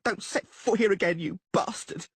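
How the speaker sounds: high furious shouting; screaming. Acted. Blonde woman orders Dandelion out of her sight, screaming. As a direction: drive him off with every word.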